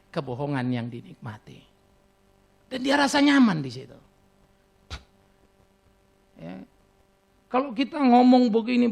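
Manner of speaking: normal talking voice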